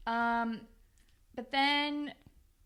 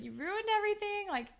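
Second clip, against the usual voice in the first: high-pitched mock wail; imitated tearful accusation; 10 semitones higher than usual. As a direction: accuse as if upset, voice raised high. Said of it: "like, crying".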